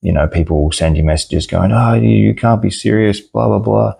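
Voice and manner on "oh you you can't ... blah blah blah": higher-pitched imitation of an indignant buyer; lightly mocking, raised voice trailing into dismissive filler; mocking complainer. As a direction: mimic a whiny protest, pitched up. Mimics complaining buyers' messages.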